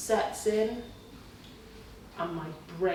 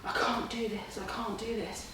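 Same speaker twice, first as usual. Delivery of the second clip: defeated moan; 11 dB quieter than usual. Retold or imitated. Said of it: "I would have been the one going".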